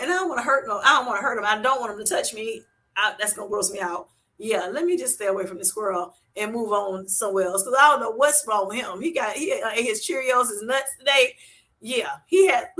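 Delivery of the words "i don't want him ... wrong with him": ordinary talk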